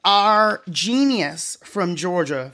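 ordinary talk, nothing marked

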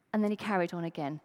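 ordinary talk, nothing marked

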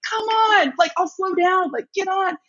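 high, insistent coaxing call; retold urging call. Urging riders onto her wheel.

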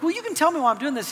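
quick mock protest. Retold reply to son.

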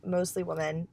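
ordinary talk, nothing marked

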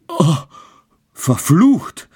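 high, loud anguished curse; horrified cry. A narrator's reading. Childeret screams on seeing the devil, horrified. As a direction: cry out in shock. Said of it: "schrie dieser".

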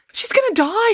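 high, loud scream of fear; frightened scream. A narrator's reading. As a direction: shriek it as she leaps off the bank. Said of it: "screamed Susan".

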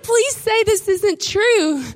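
high, slow, begging plea; mock pleading. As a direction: drag it out like a dramatic plea. Friend despairing over missing piece.

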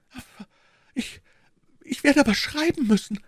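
high, halting, worried queen; alarmed hesitation. Acted. Queen realises she must write, halting and alarmed. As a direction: falter on the first words, then rise in worry.

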